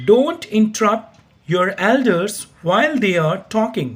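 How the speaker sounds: slow, careful teacher's model reading; slow model sentence. Shown as a demonstration. Teacher models pronunciation slowly for learners. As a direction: say each word slowly so students can copy. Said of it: "यहाँ से इसका pronunciation सीख सकते हैं".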